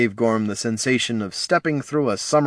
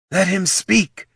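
high-pitched call, only moderately raised; insistent call; 9 semitones higher, slower than usual. A narrator's reading. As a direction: call it out firmly to the crowd. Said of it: "she called again".

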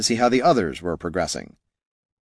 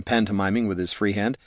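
plain reading voice

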